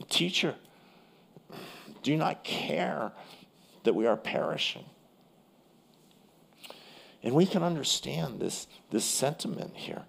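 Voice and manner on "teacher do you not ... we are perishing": panicking plea. Retold or imitated. Disciples wake Jesus in the storm, mock panicked plea. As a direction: plead as if the boat is sinking. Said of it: "and they're panicking".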